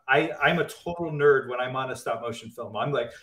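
ordinary talk

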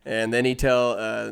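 normal talking voice

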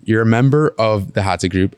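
ordinary talk

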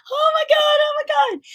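very high shouted squeal; overwhelmed squeal. Retold or imitated. Overwhelmed as race begins.

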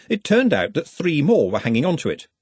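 ordinary delivery

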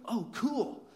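bright-pitched, eager "oh, cool"; delighted reply. Retold or imitated. Inappropriately pleased reply to injury news.

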